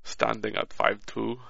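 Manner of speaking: a hint of a Russian accent, read like an autobiography; light, hesitant Russian accent. Shown as a demonstration. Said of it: "give us an example of the accent".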